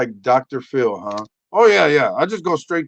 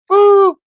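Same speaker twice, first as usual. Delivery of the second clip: imitated automatic beep; piercing mock alert boop; high loud boop; 11 dB louder than usual.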